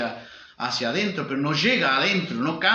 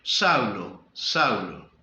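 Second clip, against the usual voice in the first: Saulo, Saulo said much lower and stern, with falling ends; annoyed repeat; 7 semitones lower, much slower than usual. Shown as a demonstration. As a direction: call his name like a vexed master.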